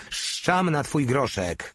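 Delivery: high, slow mild shout; shouted retort. A narrator's reading. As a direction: throw it back scornfully. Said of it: "krzyknął Stege".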